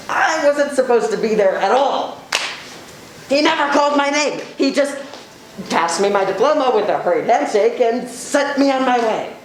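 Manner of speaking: upset rant. Acted. Upset member rants about graduation.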